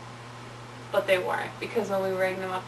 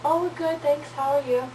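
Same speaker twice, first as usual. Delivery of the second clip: higher, sweet and polite; sweet girly voice; 6 semitones higher than usual. Retold or imitated. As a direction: put on a cute innocent tone. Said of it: "We're like".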